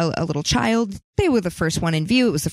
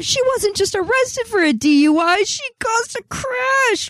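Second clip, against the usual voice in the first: incredulous; 14 semitones higher than usual. Real, not acted. Reacting to a news story.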